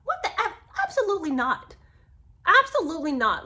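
high, loud flat refusal; absolutely not, outraged; indignant. Real, not acted. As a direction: stamp it down, incredulous. Outraged at the text.

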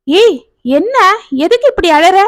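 a worried husband, lightly voiced; concerned husband. Husband asks crying wife why.

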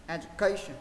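"education" in one smooth run without pauses; joined up. Shown as a demonstration. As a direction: say "education" as one word, stress on CA. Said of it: "Sau đó đọc nhanh lên".

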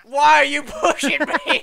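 loud, slow, outraged yell; indignant yell. Real, not acted. Shouting at his friend for pushing him in the game, outraged. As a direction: bellow it at the teammate who shoved you.

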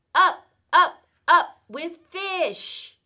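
loud high showman call, last words slow; showman's shout. Acted. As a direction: announce it like a circus act. Cat announces his big game.

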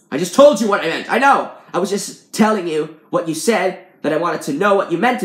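worked-up, emphatic insistence; exasperated. A narrator's reading. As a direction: argue it back with mounting frustration. Quarrel over meaning boils over.

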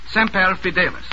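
ordinary talk, nothing marked

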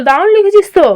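acted auntie's encouragement; encouraging praise. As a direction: cheer on a budding writer. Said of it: "বেশ উৎসাহ দিয়ে বলল".

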